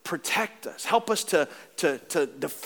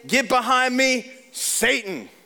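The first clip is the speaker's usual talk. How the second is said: stern rebuke; 8 semitones higher, 4 dB louder, slower than usual. Retold or imitated. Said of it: "what did he say?".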